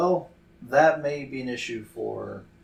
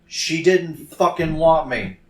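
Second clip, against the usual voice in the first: louder, raised, forceful outburst; angry shout; 5 semitones higher, 9 dB louder than usual. Acted. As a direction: shout it at her, hitting hard. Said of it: "he just shouts at her".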